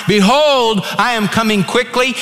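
high, belted preacher's proclamation; big pulpit declaration. Retold or imitated. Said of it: "He said it a third time".

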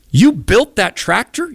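high, slow, impressed; amazed question. Retold or imitated.

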